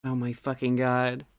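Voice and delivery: ordinary delivery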